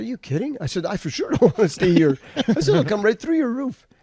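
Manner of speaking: high, loud disbelief; incredulous. Retold or imitated. Telling friends how he reacted to the hail forecast, high and loud. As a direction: exclaim it like you can't believe it.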